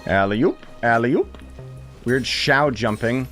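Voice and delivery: usual speaking voice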